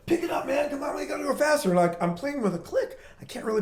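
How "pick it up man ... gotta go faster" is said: impatient singer. Retold or imitated. Singer urging band faster.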